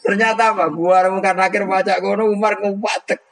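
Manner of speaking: crank it up with glee; comic shouting retelling. Retold or imitated. Umar confronting Mungkar Nakir, comic.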